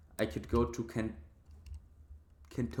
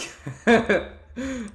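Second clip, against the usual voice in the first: high, loud burst of laughter; laughing at the rude option; 11 semitones higher, 9 dB louder than usual. Real, not acted. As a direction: crack up loudly. Refusing to steal stew.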